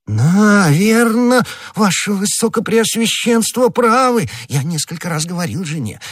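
high-pitched character voice for a gullible husband; flustered prisoner. A narrator's reading. Prisoner telling the cardinal about his wife, high-pitched flustered recollection. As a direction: agree eagerly in a high little voice.

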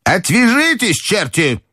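put-on very high old-lady scolding; frantic angry cries. A narrator's reading. Grandmother railing at relatives pestering her, high and angry. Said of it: "Бабушка неистово кричала на них".

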